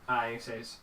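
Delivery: usual speaking voice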